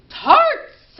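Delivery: very high loud calling shout; queen calling out. Pretend. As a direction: bellow for the stolen tarts. Queen calls for the tarts.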